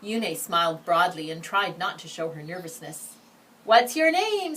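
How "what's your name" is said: loud, high classroom call; a kid shouting from the back; shouted question. Acted. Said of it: "someone shouted".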